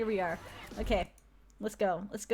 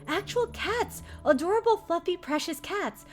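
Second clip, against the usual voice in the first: gushing over cats; 7 semitones higher than usual. Acted. Gushing about real cats.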